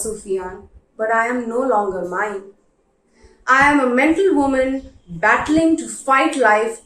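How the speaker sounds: ordinary delivery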